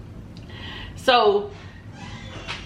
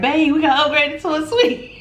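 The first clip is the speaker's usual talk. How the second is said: laughing through the news; barely holding in the surprise; giggling secret; 5 semitones higher than usual. Retold or imitated. Wanting to tell her husband about the suite, giggling.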